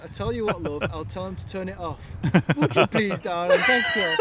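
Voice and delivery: cheeky sarcastic reply, building loud; mock sweet put-down; sarcastic retort. Cabin crew retort to passenger.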